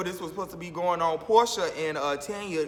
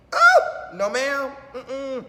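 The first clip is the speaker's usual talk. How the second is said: shocked no; 5 semitones higher, 5 dB louder, much slower than usual. Real, not acted. Reaction to the cats.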